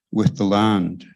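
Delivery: ordinary talk, nothing marked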